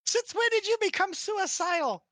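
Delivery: high-pitched, indignant character voice; Yuki. Acted. Yuki confronting Reggie about his death wish, high scolding voice. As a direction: pitch it way up, challenging him. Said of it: "Yuki just kinda puts her hands on her hips".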